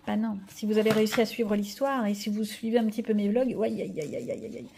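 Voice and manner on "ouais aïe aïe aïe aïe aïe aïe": fading guilty groan down to a whisper; guilty aïe aïe aïe. Real, not acted. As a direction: caught being naughty, trailing off. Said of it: "J'ai pas été sage". (aïe aïe aïe coupable et chuchoté)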